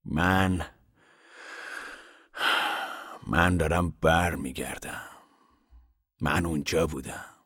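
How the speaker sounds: quiet. Acted. Ragged man to the men, quietly slow. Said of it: "مرد ژنده پوش آهسته گفت".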